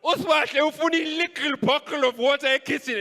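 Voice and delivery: high, loud mocking call to the family; mocking announcement. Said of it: "I called the whole family".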